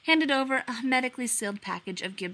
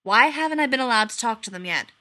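pressing; 2 semitones higher, 7 dB louder than usual. A narrator's reading. Gibbs presses angrily.

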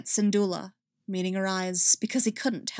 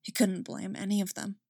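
plain reading voice

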